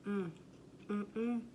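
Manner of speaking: humming while chewing food; closed-mouth mm sounds eating; mouth full, chewing. Real, not acted. Seen 0: Bit burger at -5; chewing with full mouth throughout, burger in hand.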